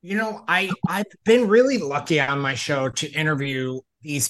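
loud, upbeat show-host delivery; podcast host intro. Acted. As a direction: pump up the welcome like a host. Host asking interview question.

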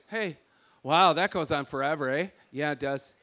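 a light Michigan, almost Canadian lilt; Michigan accent. Retold or imitated. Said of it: "we sound like Canadians".

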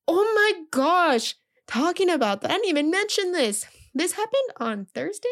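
high, loud burst of remembering; oh my gosh, I forgot to tell you; sudden excitement. Real, not acted. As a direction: blurt it out as it hits you.

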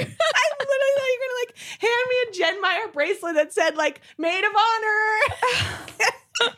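high, laughing disbelief; high-pitched giggling retelling; laughing while telling. Real, not acted. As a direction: laugh at her own mistake as she tells it. Retelling embarrassing misunderstanding.

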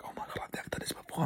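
fast, hushed, nervous whisper. Retold or imitated. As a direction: whisper it quickly like a frightened onlooker. Nervous kids watching from below.

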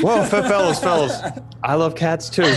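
lightly voiced owner waving the men down; flustered owner. [name] calming the armed players, high and flustered.

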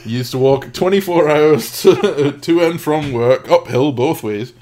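loud mock Yorkshire one-upping; hammed-up grumbling Yorkshireman; Yorkshire old man. Joining the sketch with a mock Yorkshire boast. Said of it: "Four Yorkshiremen skit from Monty Python".

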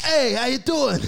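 put-on greeting. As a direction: play a goofy fan saying hello. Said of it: "It's like".

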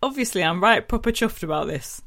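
Yorkshire accent. Shown as a demonstration. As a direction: put on a hammed-up Yorkshire accent. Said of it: "No, a, a Yorkshire accent".

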